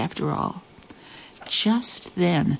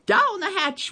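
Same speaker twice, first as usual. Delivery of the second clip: higher crew echo of the toast; crew shout. A narrator's reading. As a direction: roar it back like the crew. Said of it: "we all shouted".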